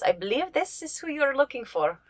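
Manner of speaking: in-character helper. Acted. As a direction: play a character making an introduction. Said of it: "taps him on the sign and says".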